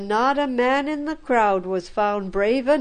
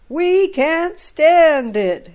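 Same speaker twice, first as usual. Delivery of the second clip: slow, louder and higher cry of despair; desperate elders; 6 semitones higher, 7 dB louder, slower than usual. A narrator's reading. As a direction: drag it out like men at their wits' end. Terrified elders in council.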